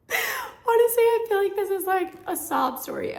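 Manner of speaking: laughing. Real, not acted. Laughs at her own story.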